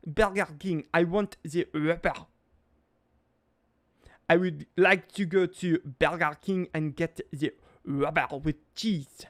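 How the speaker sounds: hammed-up Frenchman mangling English; thick French accent. Frenchman repeating his lunch order, thick French accent. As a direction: order fast food in a heavy French accent. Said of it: "Mister Frenchman".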